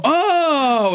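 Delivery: high, drawn-out oh of discovery; sudden realization. Retold or imitated. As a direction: let the penny drop, high and long. Acting out people getting the pitch.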